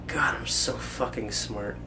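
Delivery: slow smug whisper. Real, not acted. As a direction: whisper it to yourself, pleased.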